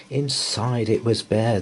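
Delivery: usual speaking voice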